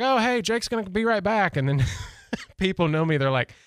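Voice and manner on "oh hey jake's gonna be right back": high-pitched, imitated believer; mock naive cheer. Friends telling each other about the departed guest, mock naive. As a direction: lightly play the trusting friend, pitched up.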